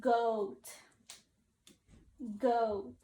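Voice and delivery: slow clear model word goat. Shown as a demonstration. Teacher modeling goat for kids to repeat. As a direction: say goat clearly for the class to copy. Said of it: "Now say it with me".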